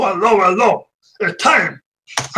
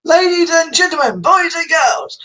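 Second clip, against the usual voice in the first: announcer call; 4 semitones higher than usual. Acted. Livestream host hailing his viewers as an announcer. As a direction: greet the crowd like a ringmaster. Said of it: "livestream host announcing".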